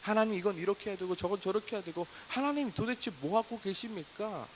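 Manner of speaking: acted insistent complaint, high-pitched; demanding prayer. Believer telling God how to fix things, demanding. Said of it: "해결책을 막 이야기하지".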